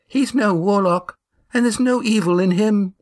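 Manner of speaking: high, searching voice of a stout widow; widow Amy. A narrator's reading. Widow testing Estrella for burning, high anxious voice.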